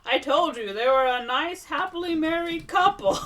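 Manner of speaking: high, loud mock protest climbing to the end; indignant hotel manager. Hotel manager scolding the intruders, mock indignant. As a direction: insist like an affronted manager, hammed up.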